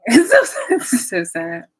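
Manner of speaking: high burst of laughter into the words; laughing. Real, not acted. Vlogger laughing at her long nap.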